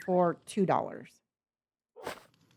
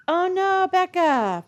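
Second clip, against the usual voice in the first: high, louder "oh no" of concern; sympathetic dismay; 10 semitones higher, 5 dB louder, slower than usual. Real, not acted. Streamer reacts to a viewer's bad news, sympathetic dismay. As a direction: commiserate with a big, high-pitched reaction.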